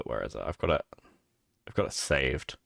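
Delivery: normal talking voice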